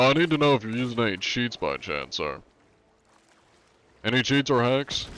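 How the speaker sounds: a hint of a prim officer questioning a suspect; lightly voiced stiff official, courteous and firm; polite inspector. Streamer as polite cheat inspector questioning a player, prim.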